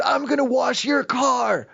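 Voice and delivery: hammed-up scary car-wash guy; scary threat. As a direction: growl the offer like a menace. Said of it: "Like that, like, real scary".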